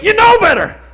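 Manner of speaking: high, loud scolding; scolding parent. Retold or imitated. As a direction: tell them off like a parent. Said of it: "and just say".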